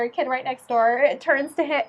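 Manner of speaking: ordinary delivery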